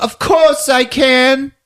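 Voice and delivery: put-on high, slow little boy boast; proud kid. Boy insisting to Yuso he can shoot, high slow. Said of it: "It's like".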